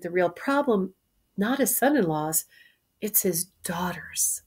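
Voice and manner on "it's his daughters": whispered reveal. Real, not acted. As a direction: lean in and whisper it. Teacher stresses the real problem.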